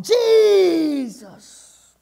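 loud, high, drawn-out cry for help; long pleading holler that falls away at the end; desperate shout. Acted. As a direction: shout after someone far ahead, holding the vowel. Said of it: "She shouted".

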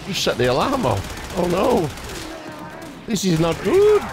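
oh no, this is bad; rising alarm. Real, not acted. Player reacting to the alarm, rising panic.

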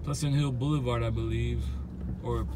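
ordinary talk

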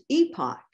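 EPOCH said the British way, as EE-pock; British-style EE-pock for EPOCH; British pronunciation. Shown as a demonstration. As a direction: say EPOCH like a Brit, long EE first. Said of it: "saying it like a Brit".

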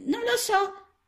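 put-on very high, small child-frog voice; a tiny lost frog, unsure; little frog. A narrator's reading. Lost little frog answering Mrs Balzelli, high and small.

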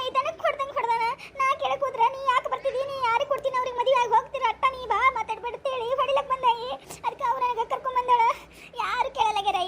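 put-on high, sobbing girl pleading through tears; crying niece. Weeping niece tells her aunt about the wedding, sobbing. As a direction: choke the words out between sobs.

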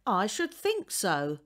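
grudging, tart and slow; landlady answering sourly. A narrator's reading. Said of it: "said Missus Walker sourly".

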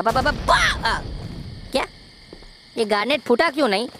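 ordinary delivery

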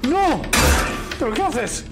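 no agudo y fuerte, alarmado; protesta alarmada. Real, not acted. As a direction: grita como quien ve un desastre. Character does wrong thing. (protesta alarmada)

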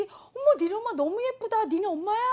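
high, loud gushing "oh my!"; excited schoolgirls. Retold or imitated. Classmates gushing over her mother's dress, excited squeal. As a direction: squeal with delight at the pretty mom.

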